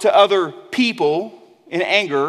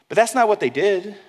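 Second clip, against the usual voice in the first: usual speaking voice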